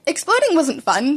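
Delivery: ordinary delivery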